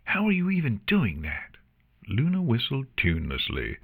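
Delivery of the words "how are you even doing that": high-pitched baffled protest; exasperated. Acted. Artemis to Luna after the ear sting, exasperated. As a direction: demand an explanation, baffled.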